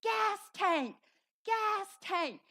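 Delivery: high, loud, urgent yell; frantic re-enacted shout; screaming a warning. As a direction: scream it to someone across the yard. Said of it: "I was screaming".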